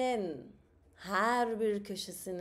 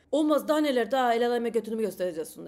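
a much louder, fast, hammed-up toxic-husband scolding; 8 dB louder, faster than usual. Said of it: "toksik maskülen nitesiyle". (kıskanç Temel karısını bağırarak azarlıyor)

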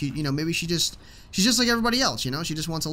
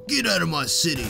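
imitating the Arrow. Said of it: "What is he, the Arrow?".

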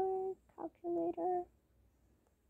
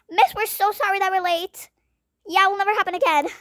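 higher, louder apologetic plea; pleading kids; 6 semitones higher, 4 dB louder than usual. Acted. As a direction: beg the teacher for mercy. Students apologizing to teacher.

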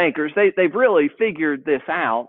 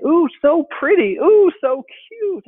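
put-on toddler voice; 11 semitones higher, 5 dB louder than usual. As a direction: gush like a proud two-year-old. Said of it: "she kept saying".

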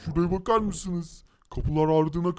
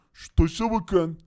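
usual speaking voice

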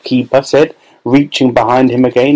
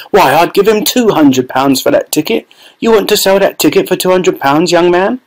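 woman voice; 7 semitones higher than usual. A narrator's reading. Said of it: "shouted a woman".

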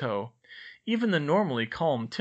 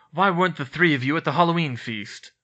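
louder, stern interrogation; lightly voiced stern teacher; Snape demanding; 4 semitones higher, 8 dB louder than usual. A narrator's reading. Snape questioning the three children, sternly.

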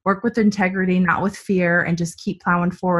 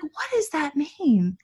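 puzzled question; 7 semitones higher, 5 dB quieter, slower than usual. Retold or imitated. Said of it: "And I'm like".

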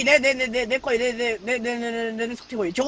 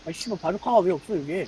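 low muttered swearing; cursing under breath; 7 semitones lower, 7 dB quieter than usual. Real, not acted. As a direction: grumble the curse quietly to yourself. Swearing at missing item.

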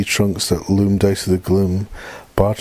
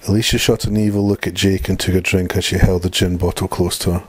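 usual speaking voice

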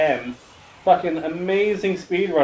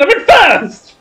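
shouting; 13 semitones higher, 13 dB louder, slower than usual. Real, not acted. Gloating over taking first place.

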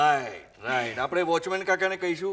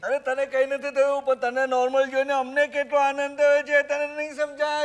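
raised, delighted exclamation; overjoyed; 4 semitones higher, 3 dB louder than usual. Acted. Doctor delighted his patient has become normal, overjoyed. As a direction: gush with joy at good news.

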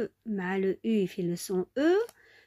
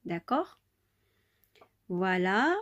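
ordinary delivery

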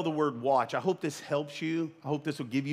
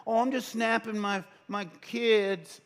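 high, innocent mock excuse; 6 semitones higher than usual. Preacher mimicking a churchgoer's excuse, high and innocent. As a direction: play the guilty one faking innocence. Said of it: "Why you got Snapchat".

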